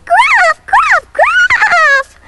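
put-on very high bleating kid goat; bleated. A narrator's reading. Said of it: "bleated the youngest billy goat".

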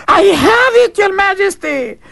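high, loud triumphant cry; excited cry. Acted. Servant crying out to the Tsar in triumph. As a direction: cry it out like a servant with a sudden idea. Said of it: "the servant cried".

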